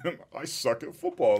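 half-laughing at his own despair. Retold or imitated. Player laughing while retelling his first practice, amused.

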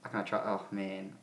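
ordinary talk

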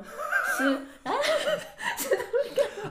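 real laughter over a slip; laughing. Real, not acted. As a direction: giggle at your own mistake. Laughing at her own slip.

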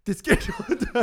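high laughing French voice; mock French accent, laughing. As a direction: squeal it in a fake French accent. Mimicking French woman, laughing.

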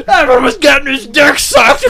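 loud, high blurted shout; excited exclamation. Retold or imitated. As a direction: blurt it out in shock. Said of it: "And you're like".

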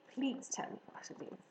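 soft whispered plea; whispered begging. Acted. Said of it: "Wilbur begged".

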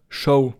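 "Show" with an ou diphthong; Upper Palatinate form. Shown as a demonstration. Teacher showing Upper Palatinate dialect, ou glide in "Show". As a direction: say "Show" for Schuh, gliding from o to u. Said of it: "sagt der Oberpfälzer".

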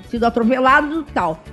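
ordinary talk, nothing marked

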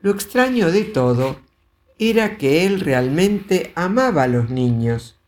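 ordinary delivery, nothing marked

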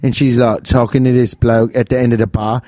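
hammed-up British storyteller; British accent. Cohost tells of Snooki being punched, mock British accent. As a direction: tell the tale in amateur British. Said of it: "we should have done Rumble Lips British".

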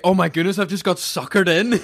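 high-pitched amused realisation; mock dismay. Retelling being drawn in at memorial, mock dismay. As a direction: exclaim as if caught out.

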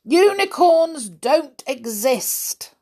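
high, slow, emphatic insistence; stubborn denial. Acted. Stubborn listener contradicting Miss Sunshine, insistent. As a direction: stretch each word to insist.

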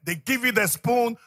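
normal talking voice

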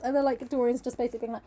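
usual speaking voice

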